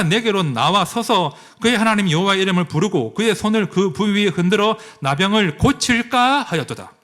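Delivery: solemn scripture read in a sermon. Acted. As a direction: read the verses out with weight. Reads the scripture passage aloud.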